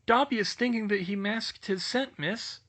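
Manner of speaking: high, eager little elf; house-elf voice. Acted. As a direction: squeak it out like a nervous elf. Dobby explains.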